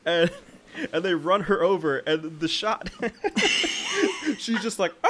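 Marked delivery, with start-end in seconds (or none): 5.0-5.1 high-pitched comic scream; hammed-up cry of terror; mock scream. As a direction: imitate a shriek through laughter. Imitates woman being run over.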